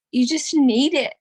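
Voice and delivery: ordinary delivery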